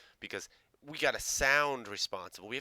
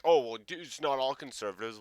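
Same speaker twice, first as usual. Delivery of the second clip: raised, protesting imitation; mock freak-out; 4 semitones higher, 6 dB louder than usual. Commentator imitating defensive conservatives, mock freak-out. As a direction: parody someone getting defensive, starting loud. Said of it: "you guys freak out".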